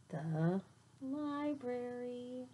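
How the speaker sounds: very slow, soft reveal; hushed reveal. A narrator's reading. Teacher revealing the secret place to kids, hushed awe.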